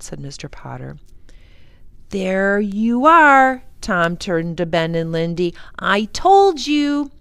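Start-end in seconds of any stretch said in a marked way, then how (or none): 2.1-3.6 loud, drawn-out gloating; slow, loud vindication; triumphant. A narrator's reading. Tom triumphant over his siblings. 5.8-7.1 high, loud, drawn-out taunt; slow sing-out of being proved right; gloating I-told-you-so. A narrator's reading. Tom gloats to siblings.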